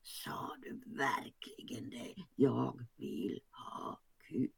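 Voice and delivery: whispered in disbelief, slowing into a giggle; hushed, shocked, breaking into laughter; giggling whisper. Acted. Pinnen repeating Bullan's line back, whispered and giggling. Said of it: "börjar också dra på munnen".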